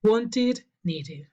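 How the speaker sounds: "wanted" and "needed" in two syllables ending "id". Shown as a demonstration. Teacher returns to the exception, "wanted, needed" ending in "id". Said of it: "Por eso".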